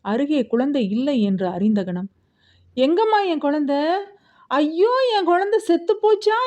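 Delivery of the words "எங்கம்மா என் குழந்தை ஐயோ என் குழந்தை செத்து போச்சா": wailing. A narrator's reading. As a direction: wail in terror that the baby is dead. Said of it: "கத்தி கதறினாள்".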